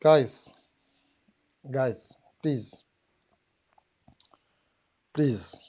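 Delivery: very low, subdued plea with long pauses; resigned pleading. Real, not acted. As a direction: plead as if giving up on them, voice dropped right down. Pleading with audience, giving up.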